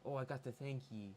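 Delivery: put-on character voice saying ye; lightly acted old-fashioned speech; Mother Righteous. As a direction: quote her with a touch of affectation. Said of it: "she calls everyone ye".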